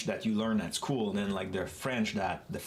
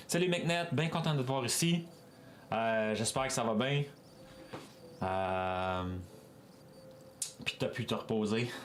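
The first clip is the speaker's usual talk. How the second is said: full Quebecois. Acted. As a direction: rattle it off in full Quebecois, pitched up. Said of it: "I will go, like, full in".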